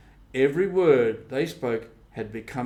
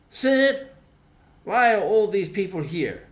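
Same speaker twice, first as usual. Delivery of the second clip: calling out to Sid; 8 semitones higher, 5 dB louder than usual. A narrator's reading. Tom calls to Sid.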